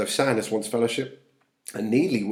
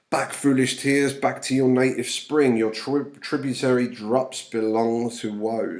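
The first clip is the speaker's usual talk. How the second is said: dramatic reading; 2 semitones higher, 5 dB louder than usual. Acted. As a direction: command the tears back with passion. Reads Juliet's lines aloud.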